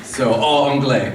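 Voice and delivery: halting French with an American accent; careful American accent, slow French words; foreign accent in french. Real, not acted. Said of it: "mon français, c'est catastrophe". (accent américain en français)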